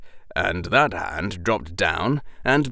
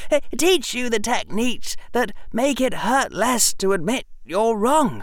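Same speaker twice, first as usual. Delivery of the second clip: put-on young Harry voice; 14 semitones higher than usual. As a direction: apologize gently from the floor. Harry apologizing.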